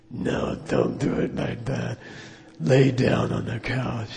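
slow, damaged-voice lama giving orders; Darth Vader. Retold or imitated. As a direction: imitate a stern teacher with a crushed voice box. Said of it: "he talked like Darth Vader".

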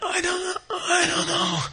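tiny high whimper, near tears; mock freaking out. Stumped logician can't answer the cowherd, whimpering mock panic. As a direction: stammer it softly in a very high, hammed-up cry. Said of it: "He's, like, freaking out".

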